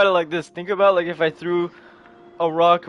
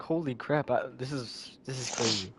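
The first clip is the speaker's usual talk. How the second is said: soft, low, faltering mock tearfulness; understated acted distress; tearful disbelief; 5 semitones lower, 12 dB quieter than usual. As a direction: stammer it quietly, acting overwhelmed. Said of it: "She's just full on crying mid-fight".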